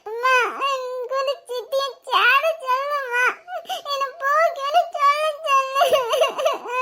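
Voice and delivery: put-on high, slow sobbing child; drawn-out tearful whine; crying child. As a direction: wail the plea to mum through sobs. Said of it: "நீ எதுக்கு அழுவுற?".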